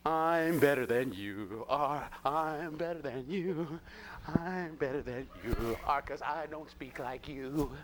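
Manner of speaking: slow, drawn-out mock snobbery; slow sing-out of mocking superiority; superior attitude. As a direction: drag every word out, self-importantly superior. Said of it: "I don't have to walk around going".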